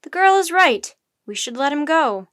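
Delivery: loud, high opening, easing slightly; shouting. A narrator's reading. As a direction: speak up forcefully over the crowd. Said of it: "he shouted".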